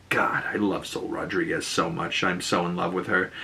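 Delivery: soft, low confession of a crush; hushed adoration. Real, not acted. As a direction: drop the voice and swoon quietly. Host gushes aside about favorite actress.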